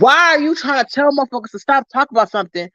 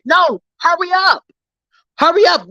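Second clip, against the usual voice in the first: higher, slow yelled dare; taunting shout; 7 semitones higher than usual. Real, not acted. Vlogger yells a taunt at trolls to hurry up.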